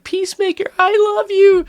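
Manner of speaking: put-on very high little-kid voice, adoring. Little kid at a stoplight greeting his hero, very high child voice. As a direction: squeak it out like a starstruck child. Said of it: "this little kid's like".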